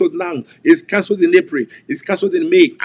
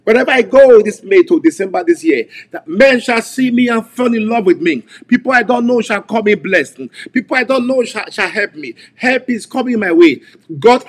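higher and louder, declaring blessing; preaching at full force; 4 semitones higher, 4 dB louder than usual. Acted. Preacher declaring favor to congregation, full-force preaching. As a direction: proclaim it over the congregation.

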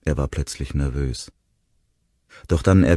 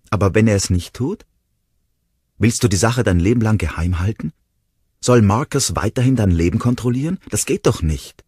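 angry reproach; 7 semitones higher, 6 dB louder than usual. A narrator's reading. Discusión, Ronnie le reprocha.

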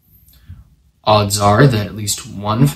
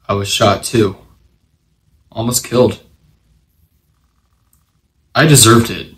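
subdued confession; 3 semitones lower, 6 dB quieter than usual. A narrator's reading. Widowed ex-marshal confesses his guilt over his wife's murder, subdued. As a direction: let the guilt sink in, softly and haltingly.

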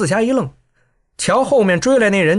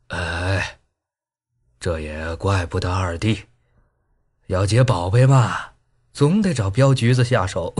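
put-on low old voice; 10 semitones lower than usual. A narrator's reading. Old Xiaoyaozi calmly answering his brothers, low voice. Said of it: "逍遥子道".

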